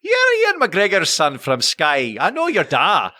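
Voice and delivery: put-on high, drawn-out, insistent Scottish accent; Scottish lady. Scottish lady insists on his parentage, high stubborn Scottish accent. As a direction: contradict slowly in a high Scottish voice. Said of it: "And she went".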